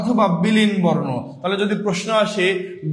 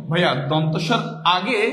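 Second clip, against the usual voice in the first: ordinary talk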